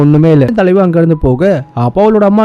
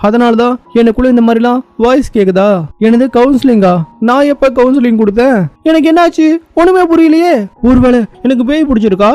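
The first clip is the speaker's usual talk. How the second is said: high-pitched, alarmed self-questioning; baffled and rattled, voice pitched up high; bewildered panic; 6 semitones higher than usual. Acted. Counsellor alone, hearing voices in the head, bewildered panic.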